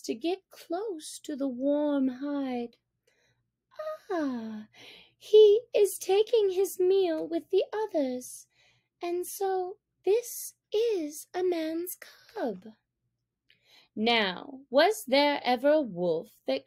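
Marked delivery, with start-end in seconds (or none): 4.1-8.5 higher, fond wonder of a mother wolf. A narrator's reading. Mother Wolf watches the cub nurse among hers, fondly. 9.0-12.7 whispered, slow, awed; hushed. A narrator's reading. Mother Wolf realizes the cub is human, whispering.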